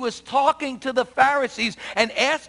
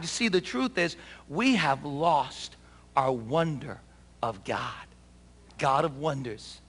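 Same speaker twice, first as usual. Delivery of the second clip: lower, softer heartfelt appeal; intimate preaching; 5 semitones lower, 3 dB quieter than usual. Acted. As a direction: draw them in quietly. Soft, intimate sermon close.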